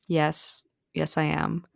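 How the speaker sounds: soft, halting admission; hesitant. Retold or imitated. Wife answering her husband's intimate question, mildly hesitant. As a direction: answer quietly after a reluctant pause. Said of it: "like, hesitantly".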